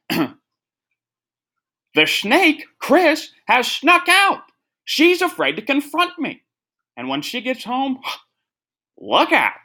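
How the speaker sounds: a shrill-free but very high mock Bruce Jenner rant; Bruce Jenner impression. Podcaster doing his Bruce Jenner impression for a loved one, very high dramatic voice. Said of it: "I have to do an impression".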